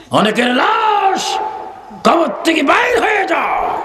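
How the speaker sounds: stretched, high-pitched sermon shout; full-force preaching. Real, not acted.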